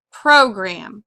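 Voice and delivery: "program" with a strong PRO, falling away on gram; stress on first syllable. Shown as a demonstration. Teacher repeating the accented word, "program" stressed on PRO. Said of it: "usually the first syllable is accented".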